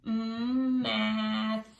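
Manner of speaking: Mm held, then math, stretched. Shown as a demonstration. Teacher stretches math out with the class. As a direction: hum the m then glide into math. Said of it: "let's stretch it out together".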